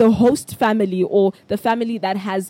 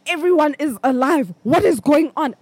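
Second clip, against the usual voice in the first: higher, louder, a rush of panic; panicked realization; 6 semitones higher, 4 dB louder than usual. Retold or imitated. Looking around the funeral tent, panicking higher and louder. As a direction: list them off in mounting alarm. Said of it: "I look over and I'm like".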